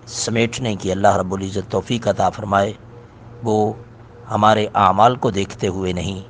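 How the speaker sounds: soft, low supplication; closing prayer. Acted. Teacher closing class with prayer for students. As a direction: pray gently and humbly.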